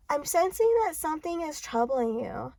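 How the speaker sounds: high, airy fortune-teller patter; mock psychic. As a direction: put on a psychic sensing secrets. Mock psychic giving a cold reading.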